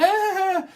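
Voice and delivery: high, loud drawn-out "aah"; sustained high teasing cry; mock squeal. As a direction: squeal it out, long and piercing. Cries out before the snot teasing.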